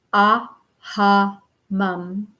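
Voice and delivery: aha mum, slowly and evenly; slow careful pronunciation. Shown as a demonstration. Teacher sounding out aha mum slowly for students. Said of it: "the first part is aha mum".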